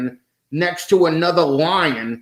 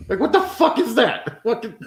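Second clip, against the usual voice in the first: laughing disbelief; 8 semitones higher than usual. Real, not acted.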